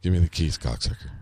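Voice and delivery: low, bored mumble fading away; bored low reading, last word nearly lost; Gabriel Byrne. Retold or imitated. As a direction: toss it off low and uninterested. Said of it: "like, bored".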